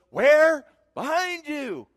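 high, loud startled cry of Where; a clown sidekick spinning around in a panic; sidekick's panicked call. Retold or imitated. Preacher acting out the sidekick for the congregation, high and loud. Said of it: "the crazy sidekick would look".

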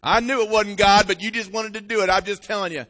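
loud told-you-so mother voice; nagging mom. Retold or imitated. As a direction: scold like a mama who knew it.

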